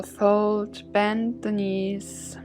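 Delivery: ordinary delivery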